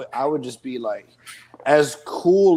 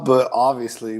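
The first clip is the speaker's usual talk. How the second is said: usual speaking voice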